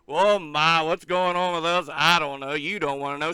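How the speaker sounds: usual speaking voice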